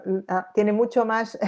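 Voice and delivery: ordinary talk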